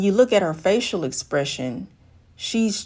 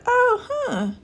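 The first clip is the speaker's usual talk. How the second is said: high, puzzled little "huh"; puzzled woman voice. Retold or imitated. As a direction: squeak out her mild confusion. Woman reacts to single answer.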